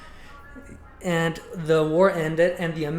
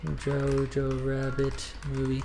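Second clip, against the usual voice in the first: slow, soft, evenly spaced words while typing; drawn-out and quiet, thinking as you type; slow dictation; 5 dB quieter, slower than usual. Real, not acted. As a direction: mumble a search query word by word. Typing a search, saying it slowly.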